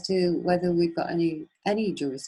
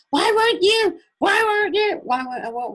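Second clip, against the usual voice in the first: shouting back; 8 dB louder than usual. Retold or imitated. Heated retort to the officer.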